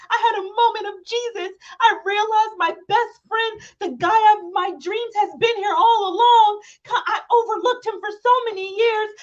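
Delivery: high, breathless, gushing lovestruck voice. Retold or imitated. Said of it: "that is literally what she said".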